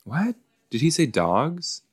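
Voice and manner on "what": sudden high what; startled question; surprised. Real, not acted. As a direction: jump up in pitch, disbelieving. Startled by line.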